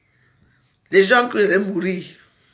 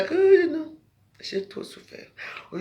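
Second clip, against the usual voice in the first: ordinary talk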